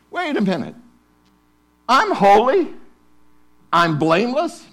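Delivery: as if hearing it for the first time; surprised. Pretend. Preacher acting a stunned believer, incredulous.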